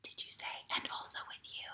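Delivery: soft whisper; whispered response. Shown as a demonstration. Teacher whispering the liturgy reply to children. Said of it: "All right".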